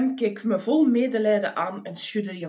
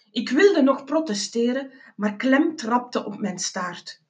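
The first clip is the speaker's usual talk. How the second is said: plain reading voice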